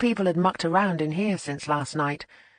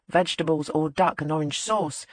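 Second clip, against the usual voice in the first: plain reading voice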